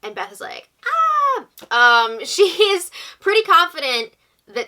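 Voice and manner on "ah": high, loud mock "Ah!"; mock squeal. Beth reacting to Jed using the veto on her, mock squeal.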